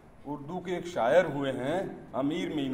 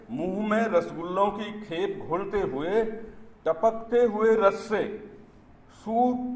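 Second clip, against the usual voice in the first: declaimed verse, higher and fuller than chat; poem recitation; 6 semitones higher, 8 dB louder than usual. Acted. Poet reciting satire on Dalit sympathy to an audience, declaimed recitation. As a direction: declaim it in measured phrases, lifting the line ends.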